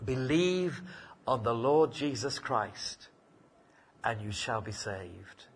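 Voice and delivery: soft, slow and hushed; quiet slow promise; simply. Retold or imitated. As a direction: lay the answer down gently and simply. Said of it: "the answer comes back very, very simply".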